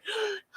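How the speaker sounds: soft high gasp, faking peace; a gentle blissful intake of breath; mock serene gasp. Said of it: "She was not like".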